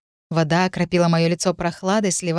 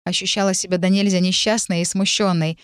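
plain reading voice

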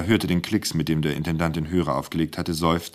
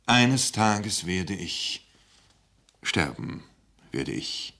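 solemn, halting; much slower than usual. Acted. Speaker finally announces his death, slow and sinking. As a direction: let the voice fall on sterben. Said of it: "er stockte".

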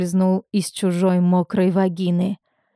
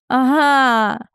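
higher, drawn-out gloating «Ага»; mocking laughed exclamation; 4 semitones higher than usual. A narrator's reading. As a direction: crow it at a rival, half laughing. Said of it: "рассмеялась Мымра". (насмешливо, будто смеясь)

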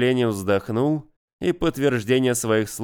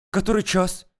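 bewildered; 8 semitones higher than usual. Acted. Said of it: "растерянно бросила девушка".

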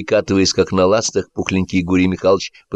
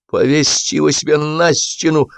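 higher, strained retort; choked, bitter reply; hoarse, squeezed-out; 7 semitones higher than usual. A narrator's reading. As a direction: force the words out through a tight throat. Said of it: "хрипло выдавил Бурчалкин".

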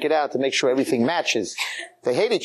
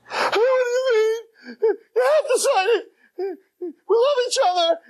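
mock crying. Girlfriend begs boyfriend to sign, hammed-up crying. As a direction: imitate a sobbing girlfriend pleading. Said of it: "And she starts crying".

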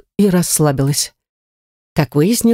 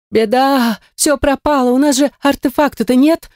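horrified cry; 9 semitones higher than usual. A narrator's reading. Lira to her friends with the artifact missing, horrified cry. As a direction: cry out in alarm, pitched well up. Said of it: "в ужасе закричала Лира".

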